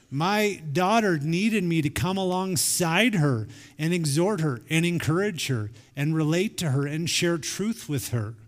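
ordinary delivery

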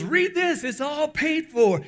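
high, loud, eager urging; excited insistence. Retold or imitated. Cashier urging a doubtful customer to read the card, excited insistence. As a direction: push the good news on them loudly.

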